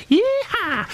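high cowboy whoop of joy; gleeful yeehaw. Retold or imitated. Celebrating a day off.

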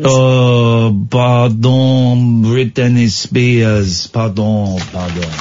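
slow, hammed-up French accent; comic Frenchman apologizing. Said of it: "in my French accent".

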